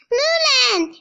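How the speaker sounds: high calling shout; excited friendly call. A narrator's reading. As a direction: call her name across the market. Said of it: "shouts Sun Ying".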